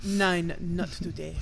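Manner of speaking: put-on light German-accented brush-off; playful German accent. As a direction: turn him down in a mock German accent. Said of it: "Some guy walks in".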